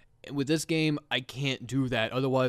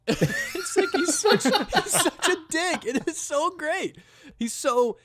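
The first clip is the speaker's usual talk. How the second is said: high, squeaky laughter through the words; slow, laughing wheeze while talking; laughing hard; 6 dB louder, slower than usual. Real, not acted. Laughing helplessly to friends about the game announcer.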